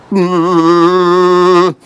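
very loud sustained hum; loud asparagus hum. Shown as a demonstration. Presenting his asparagus soup, a loud long hum.

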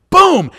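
loud, high shouted punch sound; mock punch boom. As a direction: slam out the impact noise. Punch retold with sound effect.

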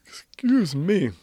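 talking through a yawn. Real, not acted. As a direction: excuse yourself mid-yawn. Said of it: "they're making me yawn".

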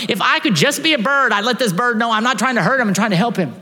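high, loud desperate wish; desperate outcry. Retold or imitated. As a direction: call out wishing to be understood. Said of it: "He's going".

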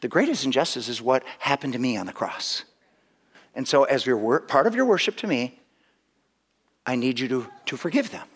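soft, tender voice of Jesus; gently. Retold or imitated. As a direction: lay it down softly, like a gentle plea. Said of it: "And Jesus just kinda gently says".

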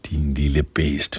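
soft, hushed whisper. A narrator's reading. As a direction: hiss it quietly at the boy.

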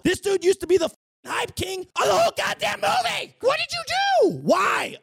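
high, loud disbelieving yell; outraged shout. Retold or imitated. Complaining to the filmmakers about Sebastian, outraged high yell. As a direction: yell it like your favorite got ruined.

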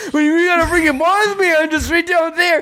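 rising, panicked pitch as he loses it; freaking out. Acted. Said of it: "I don't know why I'm freaking out about this".

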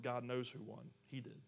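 normal talking voice

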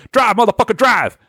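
high-pitched, frantic getaway order; acted car-chase yelp; urgent command. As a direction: urge the driver on in a hammed-up panic. Fro urges getaway driver on.

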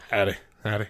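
low, lightly cowboy greeting; a hint of cowboy twang; cowboy howdy. Shown as a demonstration. Showing his habitual greeting to passersby, lightly cowboy. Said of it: "in a nice little cowboy accent".

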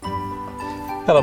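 ordinary talk, nothing marked

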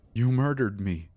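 high loud accusing scream. A narrator's reading. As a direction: scream it at him. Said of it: "Becca screams".

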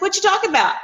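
high-pitched, casual question; mock-innocent, pitched well up; casual Jesus voice. Retelling Jesus asking two travellers what they're discussing, casual high voice. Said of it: "he's like".

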